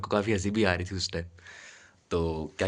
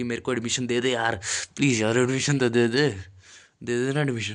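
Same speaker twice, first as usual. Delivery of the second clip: hammed-up pleading plea, higher and louder; whiny mock plea for admission; mock begging; 4 semitones higher, 4 dB louder than usual. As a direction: imitate a grovelling student, lightly mocking. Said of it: "like वो चाहता था मैं ऐसे करूँ".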